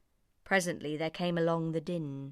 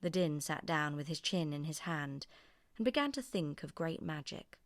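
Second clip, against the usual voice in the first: usual speaking voice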